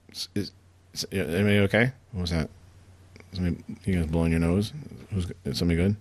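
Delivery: choppy, breaking-up audio; words cutting in and out over a bad line; bad connection. Real, not acted. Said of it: "You sound kinda choppy on my end".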